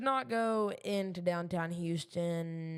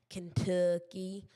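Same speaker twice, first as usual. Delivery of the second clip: southern accent; slower than usual. Shown as a demonstration. Streamer playing up Kentucky roots for viewers, southern accent. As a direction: lean slowly into a southern drawl on Kentucky. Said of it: "That's my southern accent coming out here".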